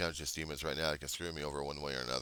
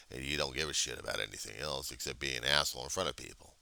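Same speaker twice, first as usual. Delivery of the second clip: usual speaking voice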